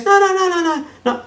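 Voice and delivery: high, rapid no-no-no in a panic; frantic pleading. Retold or imitated. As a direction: beg him not to leave. Girlfriend pleading not to break up.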